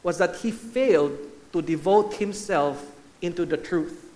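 usual speaking voice

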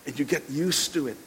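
ordinary delivery

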